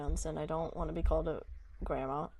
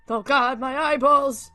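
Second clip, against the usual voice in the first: yelling in pain; 5 semitones higher, 9 dB louder, slower than usual. Real, not acted. Blinded by bright flash in game.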